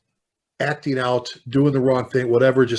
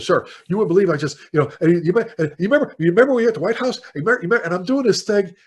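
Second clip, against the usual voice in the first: higher, louder and fast, tumbling over the words; excited gushing; 5 semitones higher, 5 dB louder, faster than usual. Retold or imitated. Retelling meeting a hero on the plane, excited fast gush. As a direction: starstruck rush, words spilling out.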